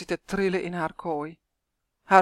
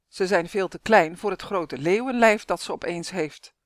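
ordinary delivery

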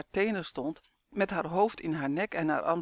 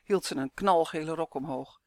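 ordinary delivery

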